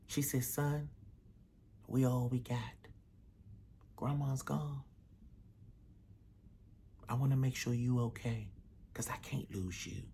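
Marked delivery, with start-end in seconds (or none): 0.4-2.6 soft pleading mother. Acted. Mother pleading softly with son. 4.0-4.8 hushed, grieving whisper; mother whispering sad news. Acted. 7.1-8.5 soft pleading mother. Acted. Mother pleading softly with son. 8.9-10.0 fearful whisper, much softer; mother whispering her fear to her son. Acted.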